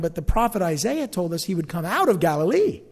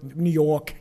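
New York accent, "York" as R-less "Yawk"; 4 semitones lower than usual. Shown as a demonstration. Preacher mimicking how New Yorkers are singled out, New York accent. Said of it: "they're from New York".

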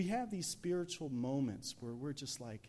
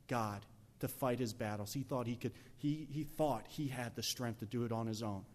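ordinary talk, nothing marked

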